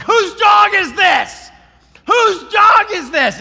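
very high, booming, drawn-out shout; yelled. Retold or imitated. Walker under threat calls for the owner, yelling. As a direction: yell for whoever owns the dog. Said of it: "so I just yelled".

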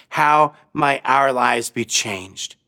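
ordinary talk, nothing marked

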